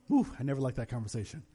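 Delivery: ordinary talk, nothing marked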